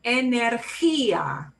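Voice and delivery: energía slowly, "eh-nehr-KHEE-ah"; stress toward the end. Shown as a demonstration. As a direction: punch the KHEE in slow energía. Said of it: "we're gonna punch it towards the end".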